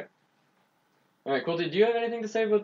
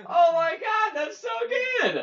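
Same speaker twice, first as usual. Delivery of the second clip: high, slow, shouted delight; excited shout; slower than usual. Real, not acted. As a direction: yell it out, thrilled. Cracks up at the pun.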